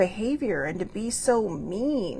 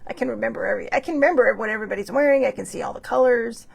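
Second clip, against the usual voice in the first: ordinary talk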